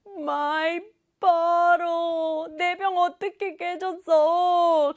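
drawn-out, high wail of distress; acted dismay. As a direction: wail over a precious broken thing. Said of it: "지우가 보면서".

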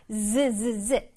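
"z" as a repeated buzzing consonant; distinct Z. Shown as a demonstration. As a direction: buzz each "z" separately and clearly. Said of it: "z 부분을 아주 뚜렷하게".

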